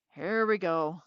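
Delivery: weary groan. A narrator's reading. Deputy Park reacts to the brothers, groaning. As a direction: sigh it out like a tired deputy. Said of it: "groaned Deputy Park".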